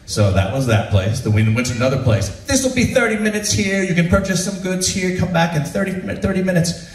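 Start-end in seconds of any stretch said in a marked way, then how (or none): 2.4-6.9 a hint of a tour guide, pitched up. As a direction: lightly mimic the tour guide's spiel. Tour guide voiced in story.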